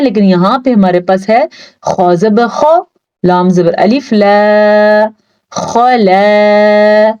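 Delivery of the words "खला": खला with heavy full खौ; heavy kha. Shown as a demonstration. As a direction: read खला thick and slow. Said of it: "खौ पुर". (खला को पुर पढ़ें)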